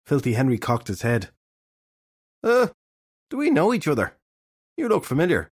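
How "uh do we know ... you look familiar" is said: high, uncertain questioning voice; unsure if they've met; puzzled detective. A narrator's reading.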